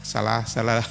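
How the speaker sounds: deep, unbothered put-down; low, confident correction; low dismissive retort. Acted. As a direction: brush it off calmly and low.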